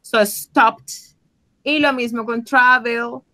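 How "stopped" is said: "stopped" said clearly with a final t; teacher models "stopped"; modelled word. Shown as a demonstration. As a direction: show the doubled-p past form. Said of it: "acá tiene dos".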